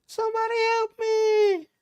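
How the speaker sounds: loud, very high-pitched eerie call; imitated cry for help. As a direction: mimic the same high plea on one held pitch. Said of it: "And then again".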